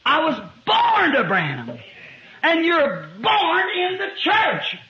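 high, drawn-out preacher's cadence; emphatic preaching. Real, not acted. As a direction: drive each point home, slow and high. Preaches at raised pitch.

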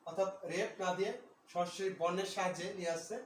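ordinary talk

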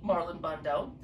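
ordinary delivery, nothing marked

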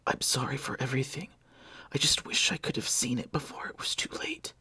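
forced, breathless whisper; gasping for air. Acted. Dying Blair apologising, gasping for breath. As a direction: push each word out short of air. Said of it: "words were forced as he was gasping for air".

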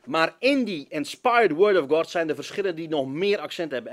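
ordinary talk, nothing marked

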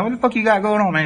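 high, disbelieving question; pressing him for answers; shocked outburst. Retold or imitated. To the cellmate, high disbelieving question. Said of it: "I'm like".

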